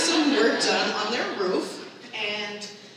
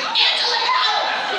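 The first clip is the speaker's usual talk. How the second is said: very high, loud shout; guardian angel's cry; 6 dB louder, slower than usual. Retold or imitated. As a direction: yell it as she starts to fall. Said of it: "It was like".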